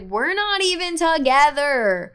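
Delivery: put-on telling the boyfriend they aren't even together, exasperated; exasperated protest. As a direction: spell it out to him in exasperation. Retold argument with boyfriend.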